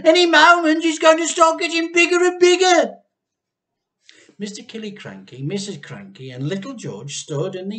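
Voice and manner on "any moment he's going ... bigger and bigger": shout. A narrator's reading. Mr Cranky urging everyone to watch, loud shout. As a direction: yell with wild excitement.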